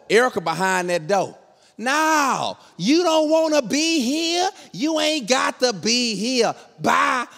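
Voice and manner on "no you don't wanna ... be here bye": high, drawn-out mock refusal; defiant wife. Wife shutting husband out, defiant. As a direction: stretch each refusal out, high and final.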